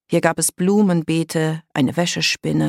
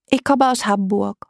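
higher voice with a blocked nose; congested girl voice; stuffed-nose girl; 5 semitones higher than usual. A narrator's reading. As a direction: announce it through a bunged-up nose. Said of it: "Ihre Stimme klang, als wäre ihre Nase verstopft".

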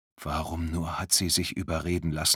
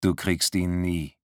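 icy. Acted. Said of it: "Die Stimme ist eisig wie der Schnee".

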